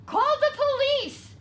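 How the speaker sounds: high, loud frantic cry; cried out. A narrator's reading. Henny Penny crying out for help, loud and frantic. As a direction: shriek for the police in panic. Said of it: "she cried".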